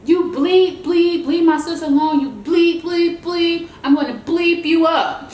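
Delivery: loud, high mock yelling of a little kid; hammed-up furious kid cussing; angry cussing. Little brother yelling at the bully, loud and high. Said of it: "He starts cussing her out".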